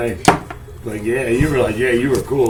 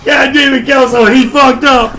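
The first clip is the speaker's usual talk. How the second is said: shout; 10 semitones higher, 5 dB louder than usual. Real, not acted. Friends joking, shouting accusation.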